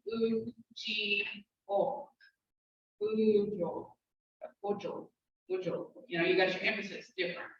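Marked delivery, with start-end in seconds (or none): 3.0-5.9 Bujo with a long oo sound, said softly; Bujo, drawing out the oo. Shown as a demonstration. Teacher shows the oo sound in Bujo, softly. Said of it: "So it's got the oo sound".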